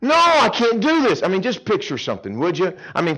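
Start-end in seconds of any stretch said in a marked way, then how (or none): 0.0-1.2 high, loud, desperate plea; pleading Moses. Retold or imitated. Moses pleading with God to refuse the task. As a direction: beg to get out of it. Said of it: "Moses says".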